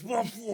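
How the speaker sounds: woof woof, barked high and loud like a dog; loud mock dog barks; mock barking. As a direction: bark it out like a mocking dog. Mocking the pledge as barking.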